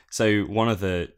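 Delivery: ordinary talk, nothing marked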